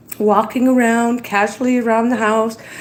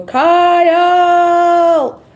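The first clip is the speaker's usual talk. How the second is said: loud high call of Kyle; screaming a name; 6 semitones higher, 5 dB louder than usual. Shown as a demonstration. Vlogger practicing calling out to a viewer, loud call. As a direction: belt the name out across the room. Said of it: "I've been practicing screaming out for you".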